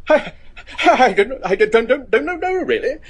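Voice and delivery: flustered stammer. Acted. Professor rattled by a question about life after death, stammering. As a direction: caught off guard, stumbling over the answer.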